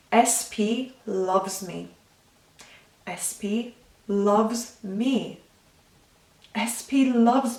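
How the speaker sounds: slow and felt, every word meant; with emotion. Shown as a demonstration. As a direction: savour each word as if you believe it. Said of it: "where you're attaching emotion".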